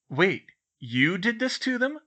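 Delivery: high, loud, incredulous question; demanding. A narrator's reading. Ron confronts Hermione about the prank, demanding. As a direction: demand it in disbelief. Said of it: "Ron demanded".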